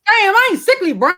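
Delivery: high, mock-offended protest; playful indignation. Real, not acted. [name] protests to her friend Brian, high mock offense. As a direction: act wounded, half joking.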